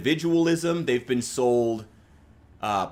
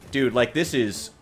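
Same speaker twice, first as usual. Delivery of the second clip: normal talking voice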